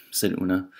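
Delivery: ordinary delivery, nothing marked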